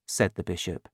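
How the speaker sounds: plain reading voice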